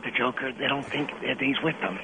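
hushed phone whisper. Real, not acted. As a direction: keep your voice down in the theater. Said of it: "why are you whispering?".

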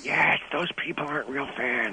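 hushed phone voice from a theater; whispering on the phone. Real, not acted. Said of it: "why are you whispering?".

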